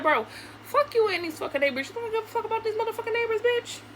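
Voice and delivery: high-pitched, indignant rant; re-enacted angry retort. Re-enacting quarrel about the neighbors.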